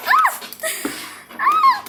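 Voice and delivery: very high, loud shrieks; piercing scream. Acted. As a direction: shriek in the brawl. Gritos en la pelea. (chillidos agudísimos)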